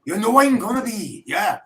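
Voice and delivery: lightly Welsh, high, loud and laughing; Welsh accent. Shown as a demonstration. Podcast host tries a Proclaimers line, laughing mock Welsh. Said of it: "sing it in a Welsh accent".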